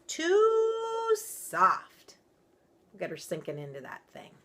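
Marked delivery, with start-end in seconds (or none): 0.1-2.2 mama bear voice. A narrator's reading. Mama bear bed, higher.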